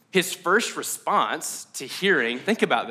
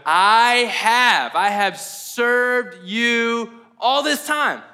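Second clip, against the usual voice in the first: slow, raised, hammered-out grievance; indignant protest, drawn out and higher; angry older brother; 4 semitones higher, 4 dB louder, much slower than usual. Acted. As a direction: act out resentment, stretching every word. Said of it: "first thing you feel is anger".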